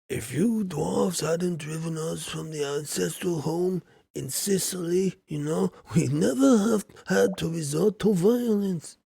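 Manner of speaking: lightly voiced mobster, rising as it goes; a milder second go at the Godfather; a hint of Sicilian mob boss. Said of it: "Krobus talks like the Godfather".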